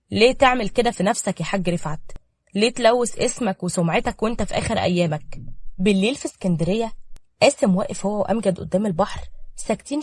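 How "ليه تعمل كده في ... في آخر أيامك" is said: ordinary delivery, nothing marked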